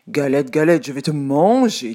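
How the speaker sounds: loud hungry wolf; wolf's threat. A narrator's reading. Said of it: "dit le loup".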